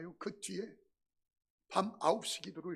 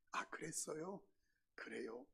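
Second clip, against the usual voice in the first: much softer, lower, deflated; hollow reply; 5 semitones lower, 13 dB quieter than usual. Retold or imitated. Pastor mumbles a deflated answer to the neighbour. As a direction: barely get the words out, crushed.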